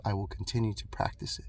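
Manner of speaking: breathy whispered vow, voice dropped right down. Real, not acted. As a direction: say it under your breath, like a private promise. Quiet vow about practicing peace.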